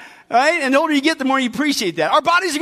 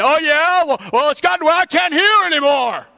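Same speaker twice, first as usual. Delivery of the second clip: higher, hollering hard-of-hearing senior; loudest voice; 3 semitones higher than usual. Acted. Preacher acting the loudest senior topping the others' ailments, hollering. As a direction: pipe up like someone who can't hear themselves. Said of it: "the person with the loudest voice piped in".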